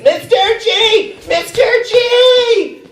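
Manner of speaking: put-on high, slow little girl call; squealing child's voice; kid calling out. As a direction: shout his name like an excited kindergartner. Kids running up calling teacher.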